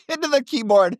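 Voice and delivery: amused, high laughing speech. Real, not acted. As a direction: laugh through the words, pitch leaping up. Laughing at own first typed message.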